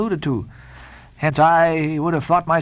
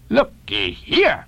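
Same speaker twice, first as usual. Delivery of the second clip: slow, high-swooping character voice; Queequeg's voice; 10 semitones higher, much slower than usual. A narrator's reading. Queequeg shaking off the stranger, slow warning. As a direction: draw out a warning to back off.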